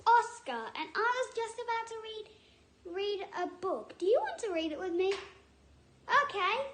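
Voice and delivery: put-on high, bright kids' show host voice; excited character introducing a book; puppet character voice. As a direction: bounce it like a cheery puppet host.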